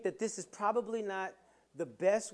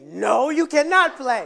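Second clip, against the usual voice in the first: mock loud refusal; 6 semitones higher, 14 dB louder, slower than usual. Father's mock retort, slow, loud.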